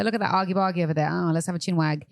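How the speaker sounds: lightly put-on British tourist voice, quick; playful mock British, fast start; mock British accent. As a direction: practise London slang in a mild British lilt. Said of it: "We can say".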